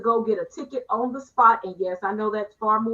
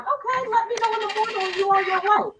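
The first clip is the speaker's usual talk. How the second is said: higher, put-on breezy voice; replying to Monty, lightly mocking; mock casual reply; 9 semitones higher than usual. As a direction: play it overly relaxed. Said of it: "I'm like".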